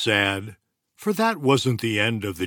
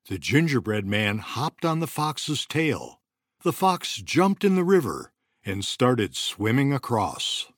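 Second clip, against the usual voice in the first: ordinary delivery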